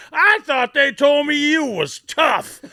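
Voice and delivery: high, loud, slow taunting shout; taunting coach. Retold or imitated. Coach Lamar Leachman taunting the flattened rookie, shouted. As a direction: jeer down at him like a drill coach. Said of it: "Lamar Leach was going".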